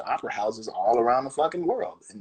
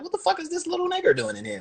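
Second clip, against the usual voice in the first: much higher, incredulous, lightly voiced; mock startled local. Regular at the curling house spotting the kid, mock incredulous high voice. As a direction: act shocked at the newcomer. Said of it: "I'm like".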